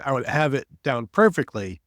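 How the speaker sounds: ordinary delivery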